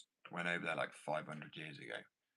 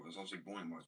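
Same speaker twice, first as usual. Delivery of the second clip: usual speaking voice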